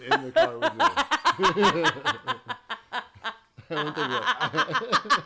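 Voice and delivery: high laughing, slow. Real, not acted. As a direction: laugh the words out. Laughing hard.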